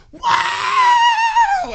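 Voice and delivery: very high, loud whooping scream; imitated excited scream. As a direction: scream like an overexcited kid. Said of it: "screaming at the top of his lungs".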